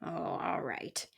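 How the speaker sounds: slow, low-volume husky grumble; growled. A narrator's reading. Porcupine giving in to the mouse, soft growl. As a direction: give in with a grouchy growl. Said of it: "he growled".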